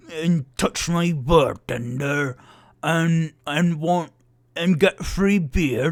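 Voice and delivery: loud, halting angry dwarf growl. Acted. Squinting dwarf confronting the boys at the bar, loud and angry. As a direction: bellow it out in chunks like an angry dwarf. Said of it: "staring even angrier".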